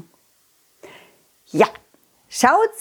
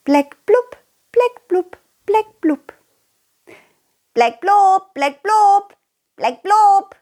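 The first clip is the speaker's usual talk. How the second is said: high, excited chorus of fish calling a name; little fish calling out eagerly, one after another; excited fish calls; 10 semitones higher, slower than usual. Acted. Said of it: "riefen alle aufgeregt".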